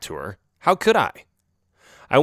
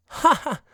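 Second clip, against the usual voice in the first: mock laugh. Oreo laughs.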